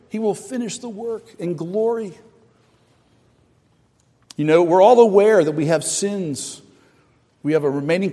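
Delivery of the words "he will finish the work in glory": "He will finish the work in glory" said softer, falling on "glory"; a quiet echo of the promise; softer repeat. Acted. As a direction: let it settle, hushed.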